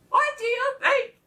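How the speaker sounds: high, excited hen voice; eager hen. A narrator's reading. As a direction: ask it high, bursting to tell. Eldest hen announces the news.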